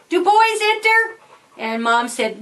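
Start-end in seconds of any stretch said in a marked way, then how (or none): 0.1-1.0 put-on high-pitched boy's yell, only a little louder; mock scream. Boy screaming out to Mom, high mock yell. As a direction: act out a child yelling for an answer. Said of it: "he screamed out to Mom".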